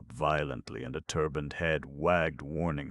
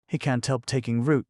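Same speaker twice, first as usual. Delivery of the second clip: put-on higher, louder indignant girl; indignant protest; 9 semitones higher, 7 dB louder than usual. Betsy defending Carter to the Pasha, indignant. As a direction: stand up for him, offended. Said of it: "protested Betsy indignantly".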